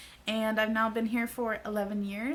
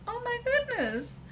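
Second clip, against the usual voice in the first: much higher, squealing oh my goodness; squealing delight; 13 semitones higher than usual. Retold or imitated. Girl thrilled at her own cheekbones in the mirror, squealing. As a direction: let it fly up into a delighted squeal.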